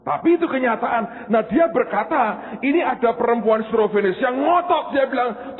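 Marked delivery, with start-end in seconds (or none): none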